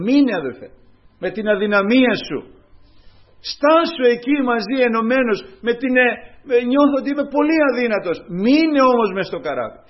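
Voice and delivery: loud, rising sermon appeal; preaching at full force. Real, not acted. Preacher pleading loudly with the congregation to hold on. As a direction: press the brothers to stay in the boat.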